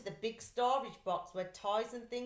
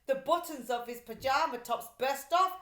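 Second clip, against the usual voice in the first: plain reading voice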